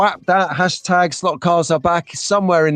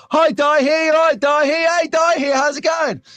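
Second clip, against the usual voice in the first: chirpy greeting chorus; 11 semitones higher, slower than usual. Retold or imitated. Mocking crowd of greeters.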